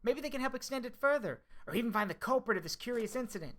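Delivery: excited. Acted. Excited gushing over investigation.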